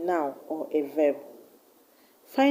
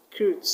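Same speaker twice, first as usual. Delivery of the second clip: "Recruit" with the stress on CRUIT; stress on second syllable. Shown as a demonstration. Teacher showing a verb's stress, "Recruit" stressed on CRUIT. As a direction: barely say "Re" in "Recruit", land on CRUIT. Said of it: "the stress will go to the second syllable".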